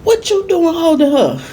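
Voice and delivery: high, slow outraged mother; mama's outraged voice. Retold or imitated. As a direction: squeal it high like an indignant mom. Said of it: "my mama come in like".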